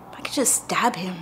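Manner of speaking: breathy hushed confession of a dark thought; whispered thought. Retold or imitated. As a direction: say it under your breath, almost afraid to hear it. Intrusive thought retold.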